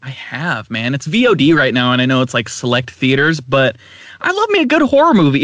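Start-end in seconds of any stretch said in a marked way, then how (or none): 4.2-5.4 excited. Real, not acted. Gushing about horror films.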